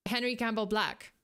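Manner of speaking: usual speaking voice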